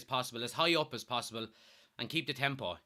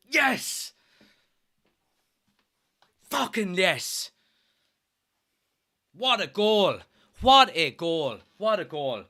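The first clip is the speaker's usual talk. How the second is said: elated; 4 semitones higher, 8 dB louder, slower than usual. Real, not acted. Fan still celebrating the goal, elated shouting. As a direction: still shouting with joy, louder.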